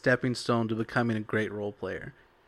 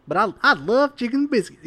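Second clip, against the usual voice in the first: much higher, louder squeaky gnome; 9 semitones higher, 5 dB louder than usual. Pretend. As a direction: chirp it like an excited little gnome. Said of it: "I'm a gnome".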